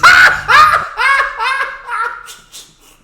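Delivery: loud, high burst of startled laughter; surprised laughter. Real, not acted. Host caught off guard by the clip, shrieking laugh. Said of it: "I just didn't expect her to use the N-word".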